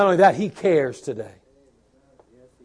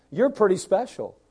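ordinary talk, nothing marked

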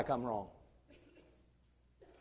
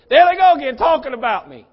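loud, higher-pitched mock outrage; mock indignant complainer; 6 semitones higher, 13 dB louder than usual. Preacher mimicking a member called out for cussing online, loud mock indignation. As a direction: cry it out like an offended gossip target. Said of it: "you hear somebody say".

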